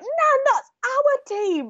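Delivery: high, drawn-out exasperated protest; mock cross. Granddaughter correcting her nan during the match, mock cross. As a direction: scold in a high voice, stretching the words. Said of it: "I just got really quite cross".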